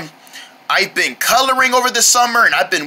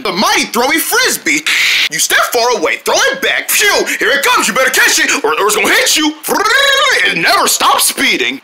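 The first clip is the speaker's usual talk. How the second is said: put-on excited kid ad voice; toy commercial; 6 semitones higher than usual. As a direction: pitch the toy with hype. Frisbee toy ad.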